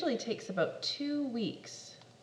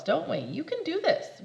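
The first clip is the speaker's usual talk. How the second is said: plain reading voice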